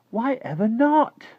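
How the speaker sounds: very high, slow, loud challenge; drawn-out, high-pitched protest; indignant girl. Acted. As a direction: demand a reason in a girl's voice. Sophie challenges the refusal.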